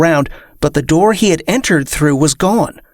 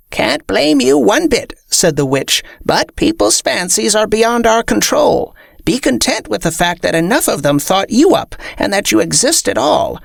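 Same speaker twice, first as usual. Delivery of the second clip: high, crone-like witch voice; old witch lecturing; 7 semitones higher than usual. Acted.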